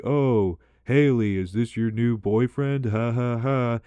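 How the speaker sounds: lightly voiced teasing smokers with a mock laugh; teasing friends. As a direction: tease her like a friend egging her on. Friends teasing outside the bar.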